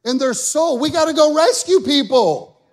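loud, high, slow fiery preaching; full-force preaching. Acted. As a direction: drive it home to the congregation, slow and loud.

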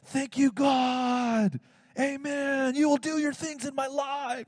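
high, slow, sweetly devout voice; pious mock prayer. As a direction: play the ideal grateful believer, drawn out. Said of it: "I should have been like".

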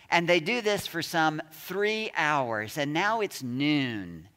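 ordinary talk, nothing marked

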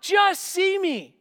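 high, shouted plea to heaven; desperate cry. Acted. Hannah crying to God in prayer, desperate shouted plea. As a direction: cry out to God, pitch pushed way up. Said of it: "She cries to God in prayer".

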